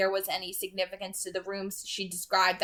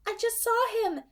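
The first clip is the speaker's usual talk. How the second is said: higher, urgent female voice. A narrator's reading. Alice telling Carlisle her vision, urgent and higher. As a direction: press it out urgently. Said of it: "she said urgently".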